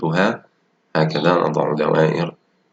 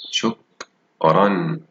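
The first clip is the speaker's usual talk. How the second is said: slow drawn-out reading; much slower than usual. Shown as a demonstration. Teacher models the underlined word, very slow. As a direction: stretch out شكرًا slowly, leaning on the final ن sound of شكرًا. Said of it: "أقرأ الكلمة التي تحتها خط".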